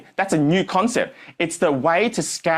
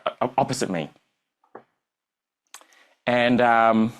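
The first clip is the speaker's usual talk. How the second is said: ordinary talk, nothing marked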